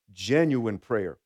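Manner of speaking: Genuine drawn out slowly, low and deliberate; stress on Genuine. Shown as a demonstration. Pastor to congregation, slow weighted Genuine. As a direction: let Genuine sink in slowly. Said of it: "I want to emphasize that word".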